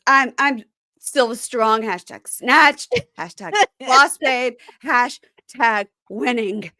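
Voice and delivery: slow, emphatic slogan-chanting; mock empowerment. Parodying her drunken boast of being strong, mock-triumphant. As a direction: drag out each hashtag like a grand proclamation.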